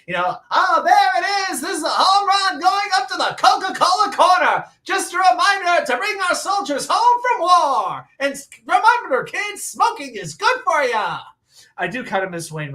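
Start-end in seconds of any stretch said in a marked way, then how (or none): 0.5-11.2 put-on high, loud old-time radio announcer; 1920s announcer voice. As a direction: belt it like a 1920s ballpark radio call. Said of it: "Wayne Randazzo with his old-time nineteen twenties voice".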